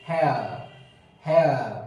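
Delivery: हेयर said as he-uh with no r; careful pronunciation. Shown as a demonstration. Teacher modelling hair for students, careful. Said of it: "यहाँ लिखा है".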